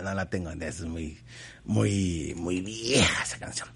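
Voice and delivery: whispered aside. Real, not acted. Aside after missing song.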